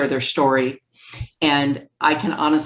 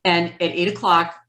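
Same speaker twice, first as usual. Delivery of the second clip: ordinary talk, nothing marked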